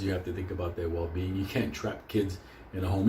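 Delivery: usual speaking voice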